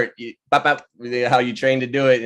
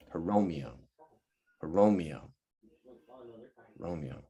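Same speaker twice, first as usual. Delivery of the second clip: each Romeo softer and lower until it is gone; fading echo; 5 semitones lower, 10 dB quieter than usual. Acted. Closing line of the play, echoed into silence and fading out. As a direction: let the name die away into silence.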